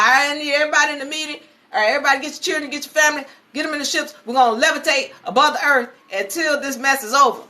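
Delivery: imitating a leader hurrying everyone along; urgent orders. Leader calls urgent meeting.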